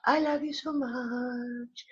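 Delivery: high, soft, very drawn-out singsong; soft affection. Real, not acted. Host repeating love to listeners, soft slow singsong. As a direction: let it float out slowly and tenderly. Repeats the farewell much more softly.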